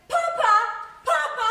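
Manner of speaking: pleading cry. Acted. Bride pleading with her father before the wedding, high cry.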